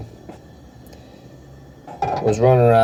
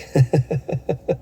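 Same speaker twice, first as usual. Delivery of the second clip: higher staccato giggle; happy giggle; 6 semitones higher than usual. Real, not acted. Pleased with what just happened, happy high giggle. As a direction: giggle in quick, high bursts.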